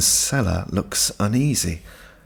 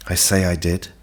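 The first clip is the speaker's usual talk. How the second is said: usual speaking voice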